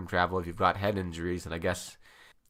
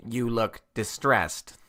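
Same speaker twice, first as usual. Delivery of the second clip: robot voice; 4 semitones higher, 5 dB louder, slower than usual. Acted. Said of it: "the robot empathized".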